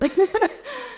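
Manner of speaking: a high, shaky laugh through held-back tears; real embarrassed giggle; teary laugh. Real, not acted. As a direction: laugh it off to keep from crying.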